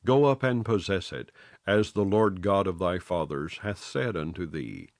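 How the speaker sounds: ordinary delivery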